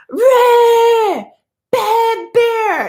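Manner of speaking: high, loud drawn-out yell; noisy scare-off shout. Retold or imitated. Woman scaring a bear off the deck, shouting. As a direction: reenact shouting to scare off an animal. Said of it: "I better make noise".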